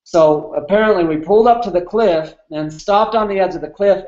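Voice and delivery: normal talking voice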